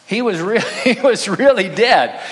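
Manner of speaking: half-laughing, real amusement. Real, not acted. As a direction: laugh it out, amused at the absurd theory. Laughs at his own sarcasm.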